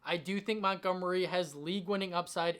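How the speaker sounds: ordinary delivery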